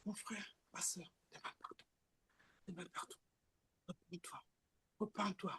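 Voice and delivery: low, soft unvoiced whisper; calm whisper. The Holy Spirit calmly urges the listener, in a whisper. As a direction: coax gently, almost silent. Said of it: "vient toujours dans le calme".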